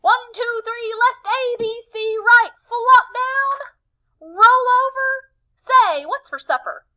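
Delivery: high, loud, rowdy yelling cheer; Tacky messing up the cheer at full volume; wild loud cheer. Acted. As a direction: bellow it with goofy gusto.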